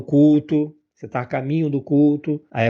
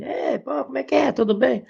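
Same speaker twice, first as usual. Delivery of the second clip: cheerful family greeting; 11 semitones higher than usual. Retold or imitated. Family spirits arriving, greeting noisily.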